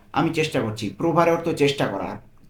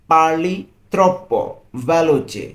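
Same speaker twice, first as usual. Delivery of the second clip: very slow, 'troppo' hit louder; drawn out, leaning on 'troppo'; slow model phrase; 2 semitones higher, 4 dB louder, much slower than usual. Shown as a demonstration. Teacher models the phrase for a learner, slow and firm. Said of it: "একসাথে আমরা পুরোটা কি বলতে পারি?".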